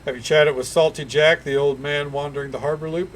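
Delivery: ordinary talk, nothing marked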